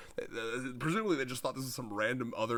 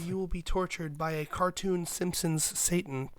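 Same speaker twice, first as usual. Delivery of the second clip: higher, louder, slow hammed-up doom voice; mock menacing threat; 5 semitones higher, 5 dB louder, slower than usual. Shizuka threatening the attacker, hammed-up doom. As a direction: threaten like a vengeful avenger.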